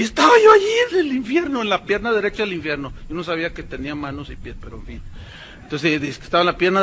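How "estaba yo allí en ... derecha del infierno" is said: put-on young woman narrating. Preacher mimicking a girl's testimony of hell, dramatic mock narration. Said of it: "una joven narrando".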